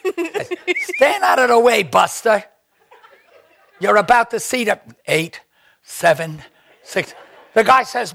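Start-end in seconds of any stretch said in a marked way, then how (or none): 1.0-4.8 louder mock order to the stagehand; mock bossy order. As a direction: shoo him off like a diva. [name] bossing the stagehand.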